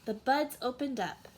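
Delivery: usual speaking voice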